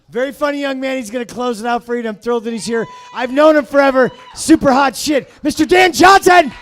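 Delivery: announcer build from brisk to shouted; emcee introduction. Real, not acted. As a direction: build to a big shouted name. Host introduces the closing comedian.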